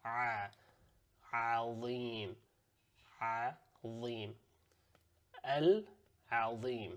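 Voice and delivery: Al-Azim drawn out slowly for repeating; slow, careful Arabic Al-Azim; slow model recitation. Shown as a demonstration. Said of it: "mimic what I'm saying".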